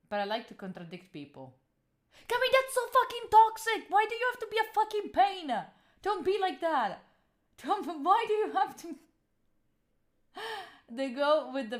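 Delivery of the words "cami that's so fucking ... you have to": high, loud, hammed-up yelling; mock furious outburst. Streamer mocking a fan's rant at her, hammed-up shout. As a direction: scold yourself like an outraged fan, over the top.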